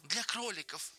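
high, eager interjection; childlike high-pitched enthusiasm; eager chiming in. A narrator's reading. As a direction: cut in eagerly, pitch lifted. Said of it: "подхватил Ленни".

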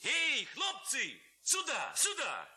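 high, loud summoning call; calling shout. A narrator's reading. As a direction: call the lads over from afar. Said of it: "кричал он". (Левко громко зовёт хлопцев)

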